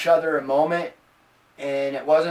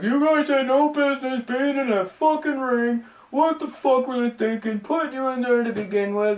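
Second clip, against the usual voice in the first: mocking critics; 6 semitones higher than usual. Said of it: "people talk shit about it".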